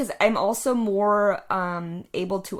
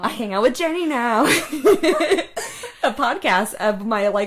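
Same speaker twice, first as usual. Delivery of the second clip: laughing; 3 semitones higher, 5 dB louder than usual. Real, not acted. Echoing friend's tease, laughing.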